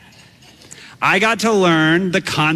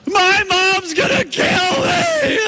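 desperate yell; 14 semitones higher, much slower than usual. Retold or imitated. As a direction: wail it for the whole beach to hear. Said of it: "what I yelled for the entire beach to hear".

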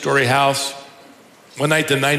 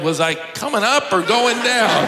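mild mock yell; 8 semitones higher than usual. Confused sister halfway up the stairs, mock yelling. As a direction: pretend to yell by lifting the pitch. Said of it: "she stopped and yelled".

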